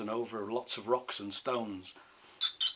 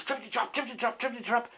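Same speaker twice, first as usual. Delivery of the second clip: high, rapid-fire hoofbeat chant; pitched-up trippity-trap charge; charging hoofbeats; 10 semitones higher, much faster than usual. A narrator's reading. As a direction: gallop through it towards the troll. Goat charges at the troll.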